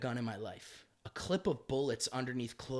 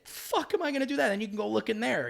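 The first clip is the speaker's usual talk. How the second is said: high, loud exasperated comeback; indignant retort; 9 semitones higher, 7 dB louder than usual. Retold or imitated. Comic retells snapping at the police chief, loud indignation. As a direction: throw it back at the chief.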